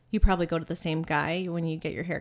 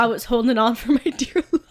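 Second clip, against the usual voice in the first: laughing; 3 semitones higher, 6 dB louder, faster than usual. Real, not acted. Laughing about the scraper.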